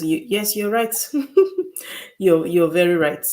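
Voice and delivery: usual speaking voice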